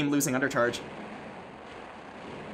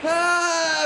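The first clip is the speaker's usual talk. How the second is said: mock panic; 12 semitones higher, 3 dB quieter, much slower than usual. Begging for a debuff.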